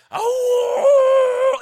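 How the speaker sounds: long, high, loud dog howl. Retold or imitated. Preacher mimicking the neighbors' dog, howling. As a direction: howl like the neighbor's hound, drawn out. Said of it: "they had a howling dog".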